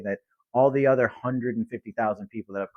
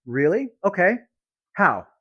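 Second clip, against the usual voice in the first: curious follow-up; 7 semitones higher than usual. Shown as a demonstration. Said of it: "Get curious".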